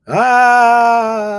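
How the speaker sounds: long high loud yell; loud test yell. Shown as a demonstration. Yelling to see if the manager turns around. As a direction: hold a big yell out. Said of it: "see if she turns around if I yell".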